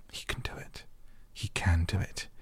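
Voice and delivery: urgent whisper; under her breath. A narrator's reading. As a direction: whisper it to yourself like a desperate chant. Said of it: "gabbed over to herself under her breath".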